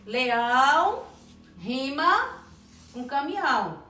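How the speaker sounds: LEÃO stretched very slowly, then pauses before "rima" and "com caminhão"; drawn-out demonstration. Shown as a demonstration. Teacher repeats rhyme, stretching it out.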